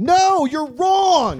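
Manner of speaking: put-on high, slow, cranky old man shout; old man yell. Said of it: "shaking my fist and yelling at the radio".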